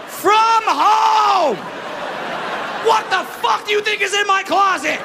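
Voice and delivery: outraged shout. Real, not acted. Comic shouting at the casting call's request. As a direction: blow up in disbelief.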